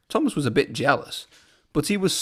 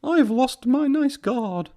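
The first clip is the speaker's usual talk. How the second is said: mock sob; 10 semitones higher, slower than usual. A narrator's reading. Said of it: "sobbed Clarabel".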